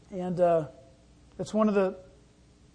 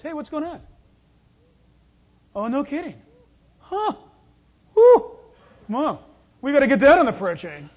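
gossiping churchgoer; 5 semitones higher, 9 dB louder than usual. Retold or imitated. Church busybodies trading gossip.

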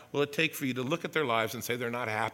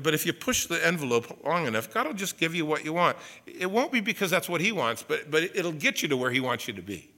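ordinary talk

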